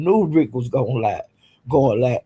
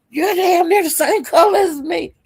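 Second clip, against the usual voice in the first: much higher mock voice of the guy; quoting the guy; 13 semitones higher than usual. Telling the chat what the guy said, high mock voice. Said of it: "the guy was saying".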